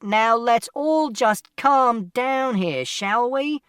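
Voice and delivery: high, loud, slow call for calm; shouted appeal. A narrator's reading. Man trying to calm the parents, shouting. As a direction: shout over the row, drawing words out. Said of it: "And Mister Shears shouted".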